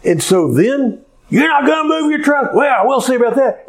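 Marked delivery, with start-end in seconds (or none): 1.3-3.6 high, rapid mock threat; irate retort. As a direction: imitate a fed-up driver making a threat, fast and high. Acted threat from irate driver.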